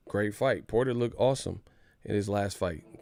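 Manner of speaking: normal talking voice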